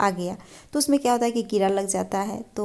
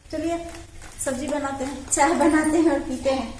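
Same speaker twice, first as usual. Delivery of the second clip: playful vendor voice; 4 semitones higher, 4 dB louder than usual. Pretend. Closing vendor role-play.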